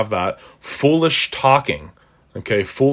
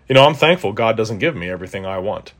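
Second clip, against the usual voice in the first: normal talking voice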